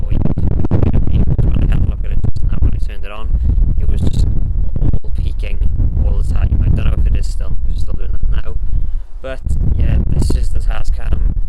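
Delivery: wind on mic. Real, not acted. Outdoor mic test in wind.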